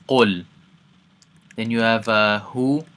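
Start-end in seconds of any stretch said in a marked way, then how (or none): 0.1-0.4 Qul with a heavy back Q, louder; Arabic Qul. Shown as a demonstration. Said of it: "One more time".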